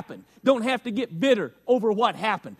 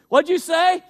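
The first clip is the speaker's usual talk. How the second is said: high, loud confronting question; raised voice, hammed-up demand; mock challenge; 9 semitones higher, 8 dB louder than usual. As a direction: play an indignant spouse catching a grumble. Acts out challenge to a grumbler.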